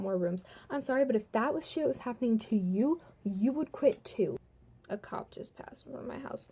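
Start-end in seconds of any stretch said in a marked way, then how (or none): 4.9-6.5 very soft, nearly voiceless murmur; hushed aside. Real, not acted. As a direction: drop right down for a private aside. Breaks off story for an aside.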